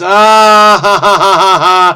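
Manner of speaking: loud, high staged cackle; big drawn-out fake ha-ha; mock laugh. Solver hamming up a crossword clue, mock laughing. Jokes after reading clue aloud.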